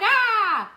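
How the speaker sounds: high, loud startled shriek; sudden high yelp; startled scream. Retold or imitated. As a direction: yelp as if caught red-handed. Guy comes upstairs, caught.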